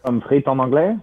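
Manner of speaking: put-on French-accented question; fake French accent. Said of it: "with a fake accent".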